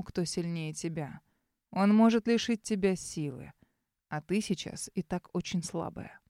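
тихий, низкий, медленный наставник; холодно и властно поучать; низкий властный шёпот. Acted. Таинственный незнакомец наставляет героиню. (низким властным полушёпотом)

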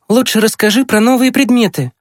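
put-on high boy's voice making a request; asking younger brother. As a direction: ask a sister in a high, lively voice. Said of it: "попросил Митя". (просьба высоким мальчишеским голосом)

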